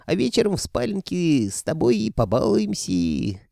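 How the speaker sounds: drawn-out, swooping taunts that climb high; leering villain. A narrator's reading. Sorcerer promising captive Alena bedroom fun, lecherous gloating. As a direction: relish each phrase, sliding up in pitch.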